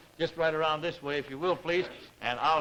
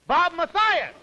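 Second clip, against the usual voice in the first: loud, high reveal of the name; big announcement; 11 semitones higher, 6 dB louder, slower than usual. Real, not acted. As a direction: announce the champion grandly. Host reveals Olympic champion.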